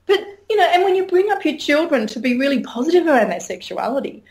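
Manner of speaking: normal talking voice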